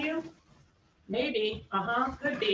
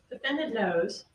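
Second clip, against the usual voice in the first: normal talking voice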